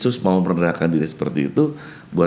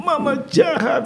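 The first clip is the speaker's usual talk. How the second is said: put-on high little-kid voice, slow and accusing; child's voice; slower than usual. Grandfather retelling the grandchild accusing her mother, high child voice. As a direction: say it as the small grandchild would.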